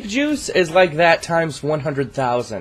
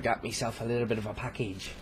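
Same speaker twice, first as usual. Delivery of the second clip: quieter pirate growl; 14 dB quieter than usual. Shown as a demonstration. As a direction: say it again like a pirate. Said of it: "I just sound like a pirate".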